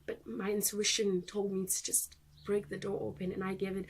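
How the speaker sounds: ordinary talk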